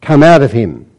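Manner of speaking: firm, level order; stern command. Acted. Jesus rebuking the evil spirit, stern command. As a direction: command the spirit calmly and firmly. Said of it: "He sternly says".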